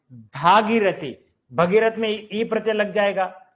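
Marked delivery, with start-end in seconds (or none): 0.1-1.3 भागीरथी with long आ, bhā-gī-ra-thī; correct form, slowly. Shown as a demonstration. Teacher models the correct word slowly, lower. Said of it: "भगीरथी नहीं".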